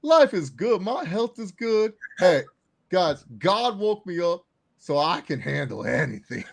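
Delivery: slow, drawn-out boastful wrestler impression; Roman Reigns. Fan quoting favorite wrestler line, slow swaggering impression. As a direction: stretch each boast like a wrestling promo. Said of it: "my favorite Roman line of all time".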